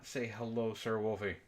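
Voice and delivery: ordinary delivery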